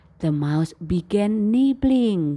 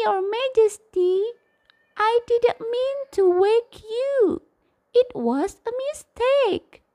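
much higher tiny mouse voice begging; pleading squeaky mouse; 13 semitones higher than usual. Acted. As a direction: squeak out a desperate plea to the king. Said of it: "begged the lion to let him go".

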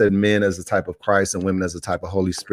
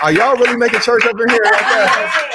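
high delighted laugh through the words, a bit louder; 13 semitones higher, 5 dB louder than usual. Real, not acted. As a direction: laugh out the question, thrilled by the noise. Host delighted by the congregation's outburst.

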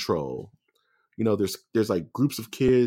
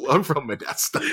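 laughing; 5 semitones higher than usual. Real, not acted. Co-host jokes, laughing repeat.

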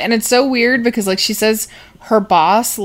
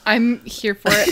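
ordinary talk

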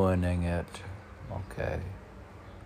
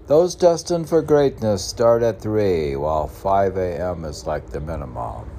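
loud, firm proclamation; declaiming; 8 dB louder than usual. Acted. Reading his own sayings to viewers, proclaiming. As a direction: read it out like a decree.

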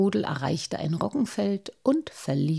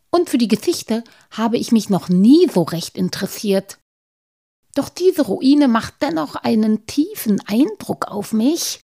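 higher, louder bashful gnome voice; a shy gnome owning up, voiced high; Halbschuh the gnome; 4 semitones higher, 6 dB louder than usual. Gnome admits to his friends his lack of taste, higher voice.

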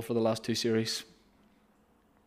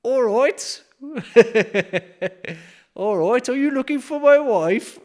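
high, loud Brummie voice with laughs; Birmingham accent; 13 semitones higher, 8 dB louder than usual. Acted. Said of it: "doing a Birmingham accent like this".

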